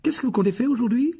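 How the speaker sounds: a brisk mother of many sons; Mrs Weasley. Acted. Molly Weasley voiced. (voix de mère plus aiguë)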